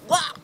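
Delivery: sudden exclamation of disgust; mock gagging "wah". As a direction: blurt an exaggerated noise of revulsion. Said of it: "I'm just like".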